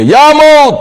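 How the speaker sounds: high, loud summons; calling out. Retold or imitated. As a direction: call Death forth in a ringing high cry. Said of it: "মউতের ডাক দেবে". (উঁচু গলায় ডাক)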